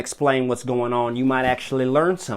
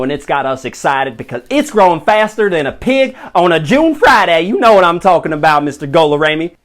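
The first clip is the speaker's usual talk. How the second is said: louder, higher eager pitch; excited rant; 6 semitones higher, 8 dB louder than usual. Acted. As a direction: get carried away boasting. Excited guest boasting.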